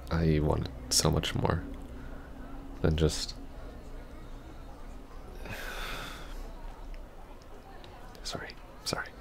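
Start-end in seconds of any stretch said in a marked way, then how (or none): 3.4-7.8 long unvoiced sigh rising as the voice gives way; shaky breaking sigh. To the ex, a sigh as composure breaks. 8.2-9.1 much softer, breathy whispered sorry sorry; whispered apology. Acted. To the ex after the sigh, whispered apology.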